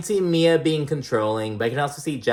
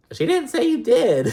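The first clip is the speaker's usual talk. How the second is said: mock castmate voice, pitched way up; high-pitched mimicked retort; 13 semitones higher, 4 dB louder than usual. Podcaster imitating a castmate's comeback to the crying woman, high-pitched retort. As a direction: squeal the comeback in a put-on high voice.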